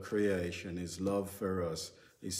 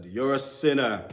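mock accusing call; 4 semitones higher, 7 dB louder than usual. Mocking blunt street witnessing.

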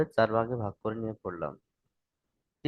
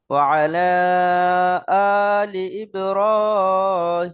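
slow, drawn-out chanting, higher and louder; Quranic-style recitation; 7 semitones higher, 8 dB louder, much slower than usual. Shown as a demonstration. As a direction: recite it for learners, stretching the long vowels. (ধীরে টেনে সুরেলা তিলাওয়াত)

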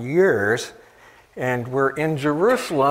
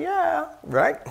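sarcastic; 13 semitones higher than usual. Real, not acted. Sarcastic aside to congregation.

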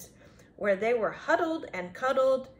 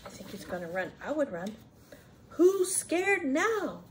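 plain reading voice

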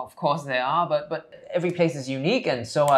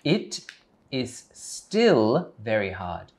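slow careful model; 3 semitones lower, much slower than usual. Shown as a demonstration. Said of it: "teacher models the sentence".

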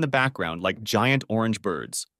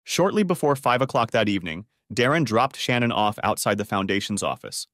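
plain reading voice

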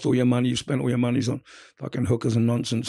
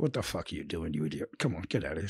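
inner voice; 7 semitones lower, 9 dB quieter than usual. Retold or imitated. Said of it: "And I was like".

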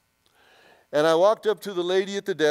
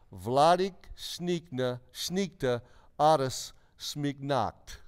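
slow, deliberate, word by word; reciting foreign words; much slower than usual. Retold or imitated. Man recalling words that came out in prayer, slow recitation. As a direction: recite each strange word separately, very slowly. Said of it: "The words".

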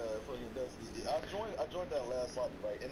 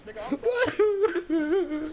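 squeaky high falsetto; 14 semitones higher, 14 dB louder than usual. Pretend. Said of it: "[name] said with a high pitch".

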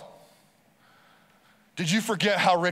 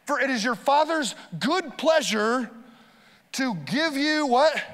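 preaching; 7 semitones higher, slower than usual. Acted. Quoting Jesus, building.

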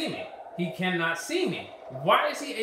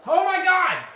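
much higher, loud outburst; exasperated shout; 10 semitones higher, 8 dB louder than usual. Real, not acted. Gamer raging at the game's enemies, loud shout. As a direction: explode in disbelief.

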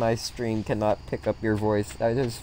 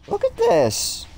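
squeaky high voice; 4 dB louder, slower than usual. Pretend. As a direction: jump way up into a tiny squeak. Repeats line in squeaky voice.

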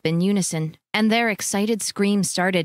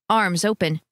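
ordinary delivery

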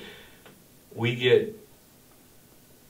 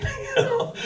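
1 burst of laughter; 3 dB quieter than usual. Real, not acted. Friends cracking up.

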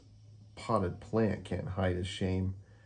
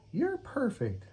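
high, sweet kitten voice; a little cat admiring her model; Kitty voice; slower than usual. Acted. Kitty character voice.

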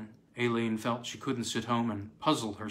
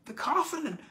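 put-on very high, wailing old man; groaning old man. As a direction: wail it, clinging in despair. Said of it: "he groaned again".

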